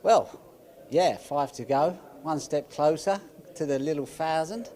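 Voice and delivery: put-on a lightly done Australian accent getting excited; Aussie accent. Ronnie jokes about his thousandth century in an Aussie accent. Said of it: "in a full Aussie accent".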